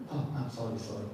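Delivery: low, soft mock apology; sheepish apology. Rescued people saying sorry later to rescuer, sheepish mock apology. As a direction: drop the voice, apologise meekly. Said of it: "have to say thank you later on".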